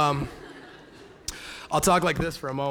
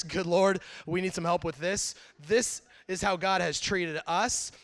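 ordinary talk

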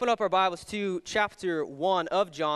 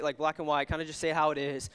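ordinary delivery

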